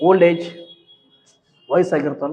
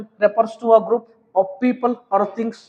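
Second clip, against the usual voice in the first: slow dictation; 6 semitones higher, much slower than usual. Shown as a demonstration. Reading out definition for class.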